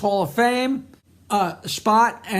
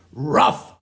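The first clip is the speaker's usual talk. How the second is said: low, short barked word; dog bark; 7 semitones lower than usual. Acted. The talking dog answers about sandpaper, low bark. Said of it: "And the dog goes".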